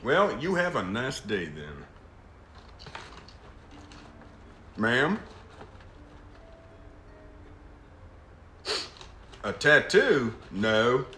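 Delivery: put-on Southern voice; Southern accent. Fielding questions from a ma'am, in a Southern accent. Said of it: "putting on a Southern accent".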